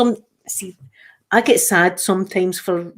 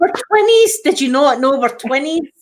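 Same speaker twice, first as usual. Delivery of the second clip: much higher and louder, delighted and playful; gleeful squeal; 6 semitones higher, 3 dB louder than usual. Retold or imitated. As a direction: squeal it like it's the best news ever. Gleefully telling dad they're twins.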